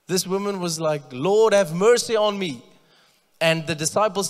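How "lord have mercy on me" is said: higher, louder pleading cry. Retold or imitated. Desperate mother begging Jesus for help, loud high plea. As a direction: call out loud to the Lord for mercy. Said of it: "so she must have been loud".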